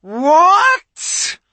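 very high, loud furious roar; enraged witch roar. A narrator's reading. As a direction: explode in rage. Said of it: "roared the witch".

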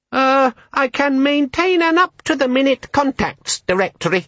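a tiny demon selling its features; high, eager electronic assistant; chirpy gadget. A narrator's reading. Disorganizer voice.

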